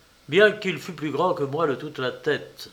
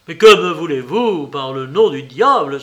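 cri fort et affolé; s'écrier; 8 dB louder than usual. Acted. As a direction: lance la question en criant. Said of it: "s'écria-t-il". (s'écrie, pris au collet)

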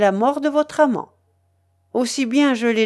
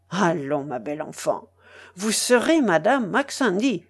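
ordinary delivery, nothing marked

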